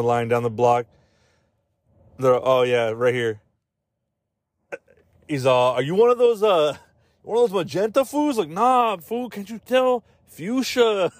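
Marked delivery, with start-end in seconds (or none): 5.7-11.1 higher hammed-up thug voice; mock gangster challenge. Gang member asking rival's color, high mock thug voice.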